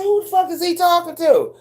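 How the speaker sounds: high, bewildered "who the fuck"; startled disbelief. Retold or imitated. Retelling her shock at a stranger in the chat, high and bewildered. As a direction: sound thrown off guard. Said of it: "I said".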